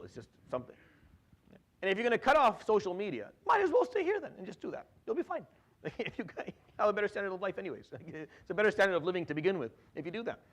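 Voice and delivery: ordinary talk, nothing marked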